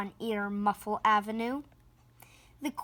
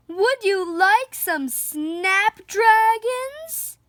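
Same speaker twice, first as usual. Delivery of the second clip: a high, slow holler; 9 semitones higher, 4 dB louder, slower than usual. Acted. Ma Loud offering flowers to the meek neighbour, slow high holler. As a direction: holler the offer over the hedge. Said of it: "hollered Ma Loud".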